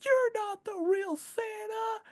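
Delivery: put-on squeaky high child, doubting; little kid voice. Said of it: "the kid looks at him and goes".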